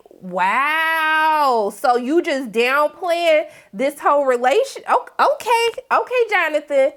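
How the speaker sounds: drawn-out, affronted amazement; mock disbelief. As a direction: stretch it out, high on the okay, putting him on notice. Reacting, affronted at his downplaying.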